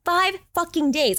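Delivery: getting worked up and incredulous; outraged disbelief. Real, not acted. Outraged about the pill timing.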